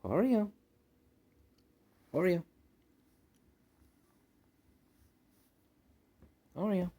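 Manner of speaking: high-pitched call to a cat; calling a pet. Real, not acted. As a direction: call the cat's name up high.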